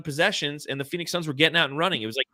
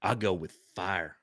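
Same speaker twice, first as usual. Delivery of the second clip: usual speaking voice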